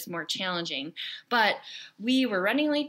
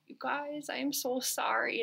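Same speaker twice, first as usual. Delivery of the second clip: soft, higher, tender sorry; remorseful apology; 5 semitones higher, 5 dB quieter than usual. Retold or imitated. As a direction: apologize gently to the kids. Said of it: "And I just was like".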